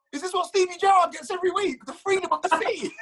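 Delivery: high, laughing incredulous question; amazed disbelief. Retold or imitated. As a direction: marvel at it, half laughing. Retelling amazed reply to waiter.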